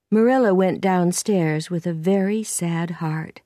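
plain reading voice

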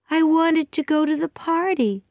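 put-on high-pitched, mildly sad girl; understated young girl's sadness. A narrator's reading. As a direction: say it up high with a touch of sorrow. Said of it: "said Anne sadly".

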